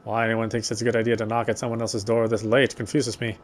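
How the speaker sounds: normal talking voice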